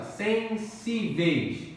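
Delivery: Sensíveis stretched slowly, weight on -sí-; slow stressed demo. Shown as a demonstration. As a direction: drag out Sensíveis, hitting -sí-. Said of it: "Paroxítona". (Sensíveis lento, com ênfase em sí)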